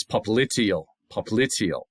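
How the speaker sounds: popliteal as pop-LIT-ee-ul, twice; stress on second syllable. Shown as a demonstration. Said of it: "Accent on the second syllable".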